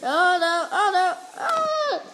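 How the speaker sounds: high, loud wail of panic; squealing oh no; panicked cry. Real, not acted. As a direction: cry out oh no as you go down. Getting killed in the game.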